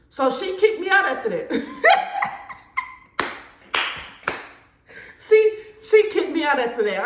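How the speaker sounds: laughing while telling it. Real, not acted. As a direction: chuckle through the line. Laughing retelling eviction.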